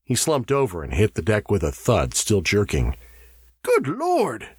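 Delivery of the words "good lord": high-pitched startled exclamation; exploding in alarm. A narrator's reading. Burford seeing the man slump and jerk, exploding in alarm. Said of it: "exploded Burford".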